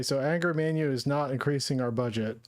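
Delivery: ordinary delivery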